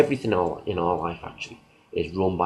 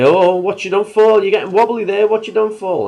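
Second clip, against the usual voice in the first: put-on much higher, much louder sing-song baby talk; teasing a toddler; 12 semitones higher, 10 dB louder than usual. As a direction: tease the little one into wobbling. Teasing a child on a fence.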